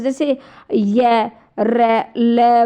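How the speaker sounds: य, र, ल said slowly, each sound drawn out apart; teacher demonstrating each consonant sound; slow sound demo. Shown as a demonstration. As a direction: spell out य, र, ल slowly for the class. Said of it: "अब मैं इनका उच्चारण कर रही हूँ".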